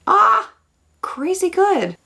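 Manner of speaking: high, loud burst of delight; giddy makeup reveal gush; thrilled gush. Real, not acted. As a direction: squeal it like you can't believe it. Gushing over her makeup result.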